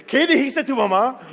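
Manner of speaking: angry father confronting his son, loud and high; furious father. Retold or imitated. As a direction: bark it like an enraged dad. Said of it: "viene como un perro en furia".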